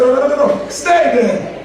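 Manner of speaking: laughing commanding refusal; half-laughing order to stay; excited rebuke. Retold or imitated. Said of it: "I said".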